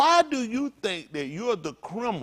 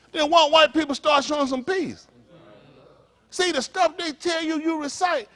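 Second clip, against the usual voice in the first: normal talking voice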